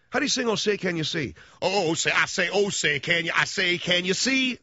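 Southern cartoon rooster blowhard sputtering; Foghorn Leghorn. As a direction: bluster and repeat yourself, I say. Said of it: "he talked like Foghorn Leghorn".

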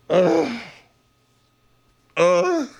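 mock disgusted grunt; disgusted ugh. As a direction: groan like it makes you sick. Disgusted groans at the woman.

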